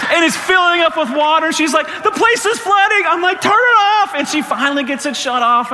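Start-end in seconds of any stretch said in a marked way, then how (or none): 1.9-3.0 high, drawn-out cry of alarm; alarmed daughter. Retold or imitated. Daughter as washer fills with water, mock alarmed wail. 3.4-4.1 loud, very high command; panicked yell. Retold or imitated. Dad to daughter as water fills washer, acted panicked yell.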